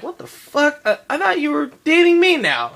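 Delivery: high, indignant what the fuck; outraged at being passed over; indignant girlfriend. Retold or imitated. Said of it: "She's like".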